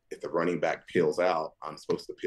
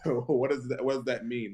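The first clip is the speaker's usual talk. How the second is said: slow, higher bewildered questions; baffled; 5 semitones higher than usual. Retold or imitated. Answering his agent about CFL teams, baffled. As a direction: play totally lost.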